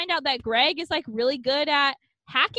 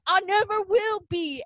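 higher, hammed-up teen melodrama; dramatic Greg; 5 semitones higher than usual. Host hams up Greg rejecting Melanie, mock dramatic. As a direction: overplay the rejection for laughs. Greg's dramatic line in recap.

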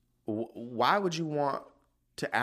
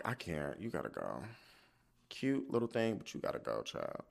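resigned giving up; 3 semitones lower, 8 dB quieter than usual. Retold or imitated. Giving up on the dog.

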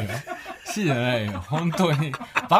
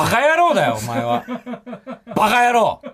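loud, high-pitched mock telling-off; shouted scolding; 7 semitones higher, 5 dB louder than usual. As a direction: yell the insult as a comic scolding. 叱りつけるツッコミ. (大声で叱りつけるツッコミ)

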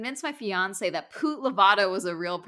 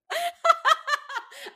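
high squealing giggle fit; helpless laughter. Real, not acted. Host losing it at own accent attempt, helpless high laughter. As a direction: crack up completely.

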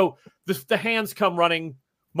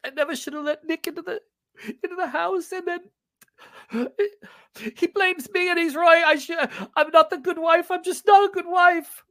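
high sobbing voice, breaking; crying; 12 semitones higher, 4 dB louder than usual. Acted. Nettie confessing her guilt while crying. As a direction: weep out the self-blame. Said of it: "She's in tears".

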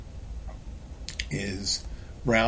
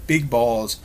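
sudden loud high blurt; mock Tourette's outburst, high and loud; 7 semitones higher, 6 dB louder, much slower than usual. Shown as a demonstration. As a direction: shout it out of nowhere. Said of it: "Tourette's outrageous statement where you just go".

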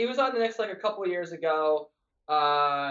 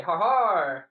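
drawn-out "ha-harr" commentator exclamation; Clyde's catchphrase; 2 semitones lower, 4 dB louder than usual. Retold or imitated. Quoting a broadcaster's catchphrase, drawn-out ha-harr. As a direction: stretch the second ha into a long har. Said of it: "favorite thing that Clyde does is say".

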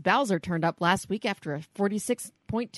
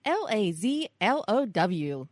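spelled out slowly; slower than usual. Shown as a demonstration. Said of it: "keyword spelled out".

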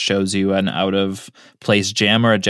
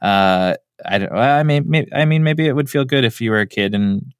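ordinary delivery

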